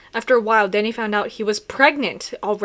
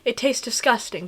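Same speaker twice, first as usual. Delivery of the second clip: plain reading voice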